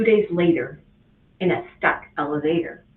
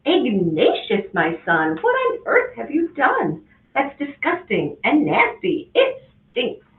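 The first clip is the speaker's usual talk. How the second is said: mother's voice climbing high in disgust; a horrified mom rising to a squeal at it stinks; shrill up at the end in disgust; 5 semitones higher than usual. Acted. Mother disgusted by dirty diapers.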